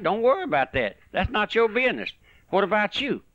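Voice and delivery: insistent high-pitched preaching; urgent exhortation. Real, not acted. Preacher telling the listener it's not their business, urgent exhortation. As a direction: press it home, pitch climbing.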